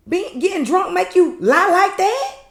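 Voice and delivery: high, loud imitation of an accusing woman; hammed-up, high-pitched shouted challenge; mock confrontational yell. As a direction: call her out in a high mock holler. Acting out Marie's confrontation.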